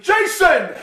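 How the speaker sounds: loud, high shout of the name; yelling. Retold or imitated. Drunk man being thrown out yells to his friend, a loud high call. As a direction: bellow for your friend as you get thrown out. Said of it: "he was just yelling".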